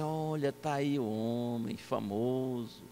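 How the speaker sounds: slow, low, softened inner voice; pensamento admirado. Retold or imitated. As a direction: think it to yourself, drawn out and hushed. Pensamento dele diante do velhinho. (pensando baixinho, admirado)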